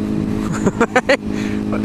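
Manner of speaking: breathy laugh, no voice. Real, not acted. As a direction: laugh at their stupidity. Laughs at the stupid people.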